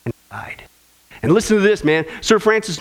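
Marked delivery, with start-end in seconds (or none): 0.1-0.6 solemn whispered ending; hushed whisper. Acted. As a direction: whisper it, almost no voice. Solemn close of each deathbed story.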